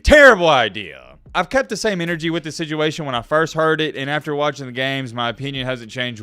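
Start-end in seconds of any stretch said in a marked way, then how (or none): 0.0-1.2 much louder, flat verdict; loud, blunt. Real, not acted. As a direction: punch it out firmly. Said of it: "I'm being sarcastic".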